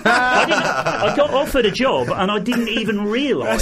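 laughing through the words in disbelief; breathless giggly high laugh while talking. Real, not acted. Laughing at his own luck.

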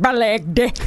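fake Spanish. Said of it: "fake Spanish".

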